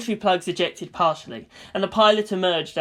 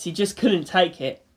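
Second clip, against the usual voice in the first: usual speaking voice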